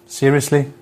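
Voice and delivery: ordinary talk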